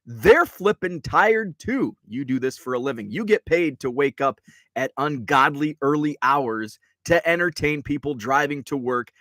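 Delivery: ordinary talk, nothing marked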